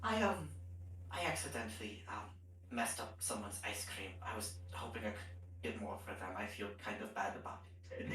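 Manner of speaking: low, very soft, halting confession; Bedlam confessing. Acted. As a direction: mumble it sheepishly, trailing into near silence. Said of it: "he's gonna be like".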